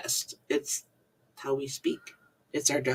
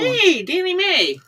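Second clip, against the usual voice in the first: cheery greeting; 4 dB louder, slower than usual. Real, not acted. Greeting a live chat viewer.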